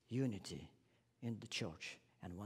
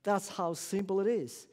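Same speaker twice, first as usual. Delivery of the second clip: normal talking voice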